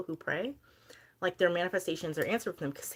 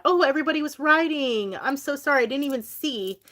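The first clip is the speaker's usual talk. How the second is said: much higher, louder sudden apology; surprised; 9 semitones higher, 8 dB louder than usual. Real, not acted. Streamer spots the flooded chat, surprised and apologetic. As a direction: caught off guard, blurt it out. Noticing unread chat comments.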